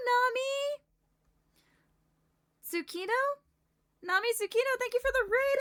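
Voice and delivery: very high, loud, squealing greeting; cute anime streamer. Real, not acted. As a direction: squeal with delight. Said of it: "greets raiders excitedly".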